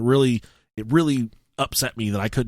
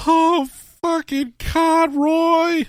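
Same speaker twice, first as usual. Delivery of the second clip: much higher, slow, drawn-out relief; mock relief; 5 dB louder, much slower than usual. Friend finally shows up, hammed-up relief. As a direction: greet him like he came back from the dead. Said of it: "And I'm like".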